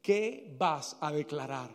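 low, soft, measured challenge; hushed solemn preaching. Acted. Preacher asking congregation what they will declare, hushed and solemn. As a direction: drop the voice and let it weigh.

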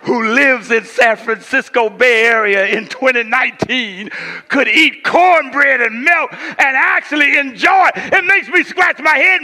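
loud, high, slow preaching build; preaching at full force. Acted. Pastor booming his daughter's puzzled words at the congregation, loud and high. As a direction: drive the daughter's words home with a shout.

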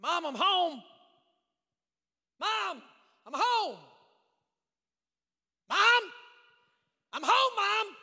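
loud, high acted holler for Mom; calling out. Boy calling to his mom on coming home, loud holler. Boy calls through the empty house.

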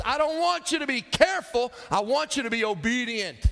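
high, loud, hammered-out order; emphatic scolding. Acted. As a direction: lay down the law to the kid. Acts grandma's emphatic final scolding.

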